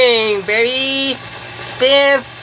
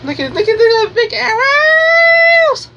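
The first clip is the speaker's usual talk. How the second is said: excited shout; 14 semitones higher than usual. Real, not acted. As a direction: yell in pure joy at the spinning arrows. Shouting over the spinner's arrows.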